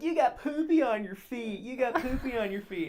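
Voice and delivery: put-on high-pitched, loud childish taunting; mock drunken screaming. Drunk friend taunting people out the window, mock screaming. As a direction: imitate a silly drunk heckler. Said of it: "he starts screaming at people really stupid things".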